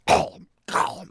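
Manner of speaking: throaty voiceless creature gulp; mimicked guttural cough-word; Gollum. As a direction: choke out the name like a creature's cough. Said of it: "you know, Gollum's".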